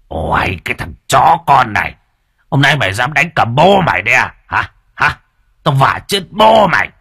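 put-on an old father shouting in fury; furious father. A narrator's reading. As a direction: roar at the boy who dared hit him. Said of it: "tức điên cả người".

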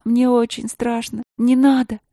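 high, frightened begging; terrified pleading. Acted. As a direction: beg for mercy in fear. Princess begs bear.

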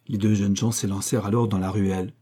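plain reading voice

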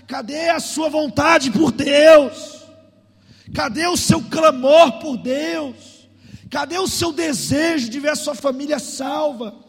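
loud, slow challenging questions; full-force preaching. Real, not acted. Pastor demanding the church's desire for God, forceful preaching. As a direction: drive each question at the congregation.

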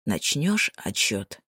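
low, slow and hoarse. Acted. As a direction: rasp out the question, slowly. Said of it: "хриплым голосом спросил".

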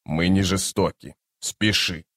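low, soft and solemn; quiet, low plea from a chief; near-whisper of a clan chief. A narrator's reading. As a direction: say it hushed and low. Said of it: "прошептал вождь клана".

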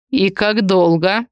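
slow, restrained near-growl; understated growling question, slowly delivered. A narrator's reading. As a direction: hold back a growl, drawing the words out. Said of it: "едва не рыча".

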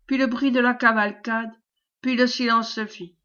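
ordinary delivery

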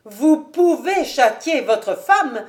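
loud, slow, high declaration; emphatic, self-possessed challenge; cool defiance. A narrator's reading. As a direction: state it deliberately, standing your ground. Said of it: "avec sang-froid".

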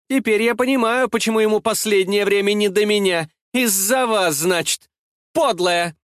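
shrill, high-pitched accusation; piercing voice of a wronged woman; piercing shouting. A narrator's reading. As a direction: scream it into the rival's face. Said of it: "кричала ей в лицо пронзительным голосом".

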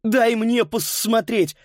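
high, slow insistent demand. A narrator's reading. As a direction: insist on it, stretching the words. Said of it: "потребовал Паскаль".

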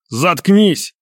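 angry growled order; muffled growl. A narrator's reading. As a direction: snarl it to silence the prisoner. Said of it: "глухо прорычал из-за моей спины Костя".

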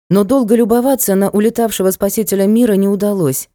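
usual speaking voice

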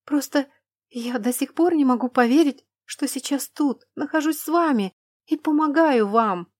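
high, bright gushing delight; breathless admiring rush; excited. Acted. Lana gushes with delight.

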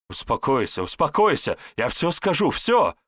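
rising to a high, loud plea; panicked pleading. Acted. Spy promising to confess at knifepoint, panicked.

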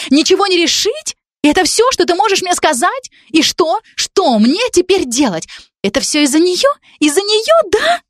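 losing control word by word; rising hysteria. Acted. Jealous girl demanding whether it's because of her, climbing to a shriek.

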